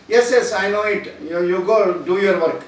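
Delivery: loud impatient dismissal; dismissive brush-off. Pretend. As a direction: shoo him back to work loudly. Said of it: "we can't say that".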